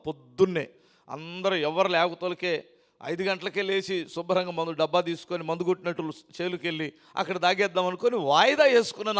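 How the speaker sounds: usual speaking voice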